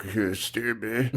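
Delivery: slow, low, mumbled mock narration; soft, dragging parody of dull narration; droning narrator impression. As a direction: drone it out like a bored voiceover. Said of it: "it's just droning on".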